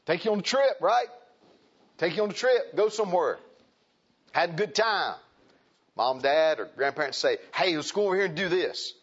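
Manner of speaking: high, loud preacher voice; animated preaching. Real, not acted. Preacher raises voice above plain talk.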